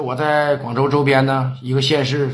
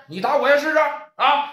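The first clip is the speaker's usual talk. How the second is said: higher taunting dare; 9 semitones higher than usual. Acted. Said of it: "叫板".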